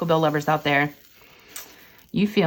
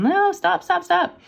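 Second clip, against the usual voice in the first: high-pitched mock freak-out, not a full scream; mock panicked screaming; 12 semitones higher, slower than usual. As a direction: imitate a squirmy kid freaking out, voice pitched way up. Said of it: "squirmy and freaking out and screaming".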